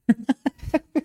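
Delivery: risada curta e aguda de surpresa. Real, not acted. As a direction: solta uma risada leve e alta. Laugh after the catchphrase. (risada aguda, divertida)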